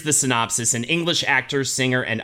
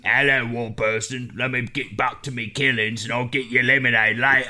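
mock soldier voice; 3 semitones lower than usual. Acted skit, soldier reply.

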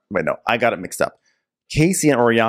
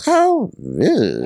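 Full House catchphrase; 8 semitones higher than usual. Retold or imitated. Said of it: "doing Full House lines".

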